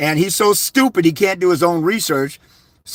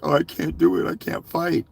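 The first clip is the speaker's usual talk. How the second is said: mocking, softer, whimpering complaint; mock whiny crybaby; 6 dB quieter than usual. Mocking men who say they can't fight, whiny crybaby voice. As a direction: whine it like a helpless baby. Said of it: "crybaby, whining, crying babies".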